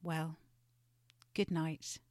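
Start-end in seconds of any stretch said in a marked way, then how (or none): none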